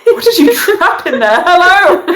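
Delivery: high, mock-indignant hail; calling out. Game master as a man addressing a trapped cactus, calling out. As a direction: call into it loudly, amused. Acted calling to something inside globe.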